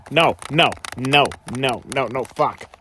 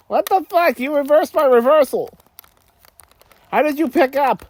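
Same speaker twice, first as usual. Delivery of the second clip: surprised; 10 semitones higher, 3 dB louder than usual. Real, not acted. Game reversal shocks him.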